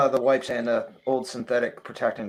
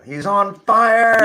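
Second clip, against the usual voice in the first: higher, louder, slow stretched announcer call; NBA Jam announcer; 9 semitones higher, 9 dB louder, much slower than usual. Retold or imitated. Gamer quoting NBA Jam, hyped announcer call. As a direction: boom it out like the arcade announcer. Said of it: "I'm just thinking N B A Jam".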